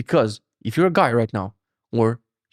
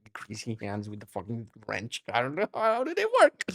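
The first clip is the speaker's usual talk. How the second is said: mock clueless guy; 3 semitones lower, 9 dB quieter than usual. Mock dumb guy excuse.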